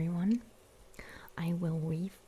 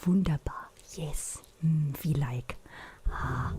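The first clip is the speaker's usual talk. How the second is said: playful, hammed-up German accent; overdone German accent; 4 dB louder, slower than usual. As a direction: put on an exaggerated German accent. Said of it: "I overdo it".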